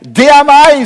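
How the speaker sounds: Jesus announcing. Acted. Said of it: "sier han".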